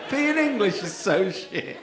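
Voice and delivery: slow, high, laughing through the words; laughing despair. Real, not acted. Comic laughing at being English after the crowd's answer. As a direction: laugh at your own country's misery.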